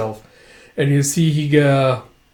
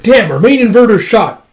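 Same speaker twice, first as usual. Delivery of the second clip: loud curse; 8 semitones higher, 8 dB louder than usual. Acted. Vehicle damage.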